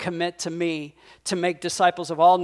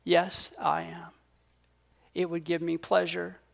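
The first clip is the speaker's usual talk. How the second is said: resolved yes; 2 semitones lower, 7 dB quieter than usual. Retold or imitated. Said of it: "takes a deep breath and says".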